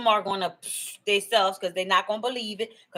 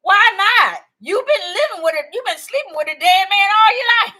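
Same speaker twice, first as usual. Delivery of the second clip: much higher and louder teasing outburst; mock shout; 10 semitones higher, 8 dB louder than usual. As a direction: yell the punchline at her sister. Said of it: "and she said".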